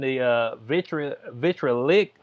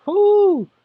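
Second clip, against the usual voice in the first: whoo; 14 semitones higher, 9 dB louder than usual. Real, not acted. Reacting to the voters' choice.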